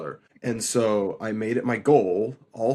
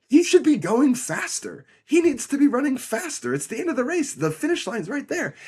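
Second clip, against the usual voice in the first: high-pitched, worked-up race-day disbelief; retold frantic urging, pitched way up; incredulous urging; 12 semitones higher than usual. Brother relives spotting his sibling near the finish, incredulous urging.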